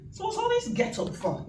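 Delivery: high, laughing mock of his voice; hammed-up high impression of the minister. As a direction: mimic his puzzled dig at her outfit. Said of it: "And he went".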